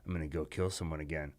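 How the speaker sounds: low, soft sinister murmur; low villain mutter. Retold or imitated. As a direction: murmur it darkly to yourself. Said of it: "He's like".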